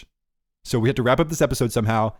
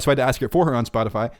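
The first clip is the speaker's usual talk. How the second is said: ordinary delivery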